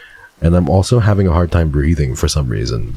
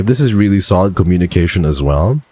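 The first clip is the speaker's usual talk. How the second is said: ordinary talk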